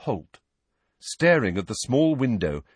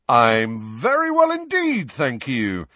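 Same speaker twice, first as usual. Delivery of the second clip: high, loud, drawn-out delight; exuberant; 4 semitones higher, 5 dB louder, slower than usual. A narrator's reading. Patient answering a supposed fan's greeting, exuberant. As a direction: swoop up with showy exuberance. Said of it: "said Lockhart exuberantly".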